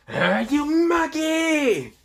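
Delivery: high, slow screeching villain voice; drawn-out villain screech. Acted. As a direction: stretch out a shrill alien sneer. Frieza insulting Issei.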